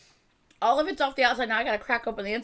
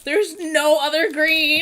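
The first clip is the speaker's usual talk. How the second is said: slow, loud and dismayed; 5 semitones higher, 8 dB louder, much slower than usual. Real, not acted. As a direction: drag it out in loud disbelief. All green candy gone.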